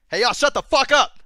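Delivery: loud, high Southern holler; hammed-up Southern yell. Shown as a demonstration. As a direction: shout it with a big Southern twang. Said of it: "put some more Southern into it".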